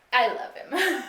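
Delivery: loud, doting declaration; doting burst; gushing over dog. Real, not acted. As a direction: gush about the puppy. Gushing about her dog.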